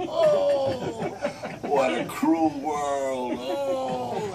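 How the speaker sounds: high laughing agony shout; hammed-up death wail. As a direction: overplay the dying, half laughing.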